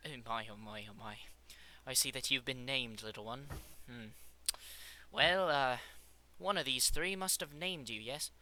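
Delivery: soft British scientist voice, musing; snooty British. Acted. Scientist talking to the little demon creature, softly snooty British. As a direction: muse quietly in a snooty British accent. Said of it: "like, snooty British".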